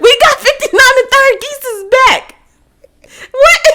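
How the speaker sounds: very high, loud squawking voice; cartoon gang animal. Geese and rams squaring up, high mock-gang squawk. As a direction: squeal the gang challenge in a hammed-up voice.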